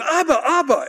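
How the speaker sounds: high-pitched eager shout; child's excited yell. Retold or imitated. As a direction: yell it like an excited little boy calling Daddy. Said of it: "he's yelling".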